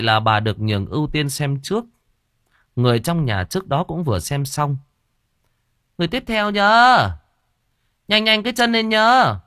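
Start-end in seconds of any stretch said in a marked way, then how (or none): 6.0-9.4 much higher and louder, calling out; shrill shaman call. Acted. Fortune teller calls the next visitor, high and loud. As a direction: hurry the next client in from inside. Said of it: "Giọng cô Sinh vọng ra".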